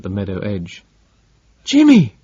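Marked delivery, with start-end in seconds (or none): 1.6-2.1 loud, very high anguished cry; a mother's cry torn out of her. A narrator's reading. Barbro sees her lost son, loud high cry. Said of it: "tore from her".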